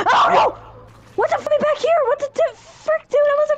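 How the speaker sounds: panicked high yelling; being chased. Real, not acted. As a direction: shriek while running away.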